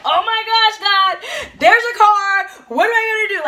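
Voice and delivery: high, loud panicked cry; panicking. Retold or imitated. A frightened daughter crying to God about the car, panicked. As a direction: freak out at the oncoming car. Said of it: "freaking out and panicking".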